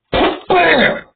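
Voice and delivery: very high, loud "bam!"; sharp sound effect of the hit; punch sound. Shown as a demonstration. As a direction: bark it like the punch landing. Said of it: "like this".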